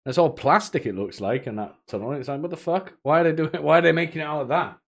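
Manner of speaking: normal talking voice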